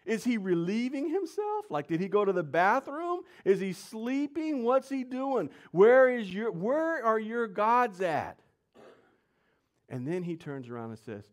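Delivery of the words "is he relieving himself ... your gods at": high, loud mock taunt. Elijah taunts the prophets about their missing gods, mocking. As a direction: jeer at rivals in a put-on high voice. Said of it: "He starts mocking them".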